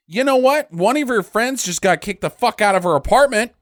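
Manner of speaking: loud, high, incredulous outburst at the character; exasperated rant. Real, not acted. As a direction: yell at her like she's ridiculous. Angry rant at movie character.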